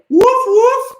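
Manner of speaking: very high, loud yapping woof woof; playful barking. Pretend. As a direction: yap like an excited puppy. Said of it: "you can point to it and say".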